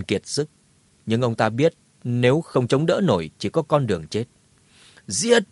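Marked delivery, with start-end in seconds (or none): 5.1-5.4 rally the last strength into one yell; battle shout. Acted. Exhausted hero yells as he charges to meet them. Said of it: "hét lớn một tiếng".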